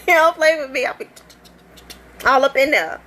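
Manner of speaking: high and loud, playful warning; sassy mock threat. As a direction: wag a finger, don't you dare.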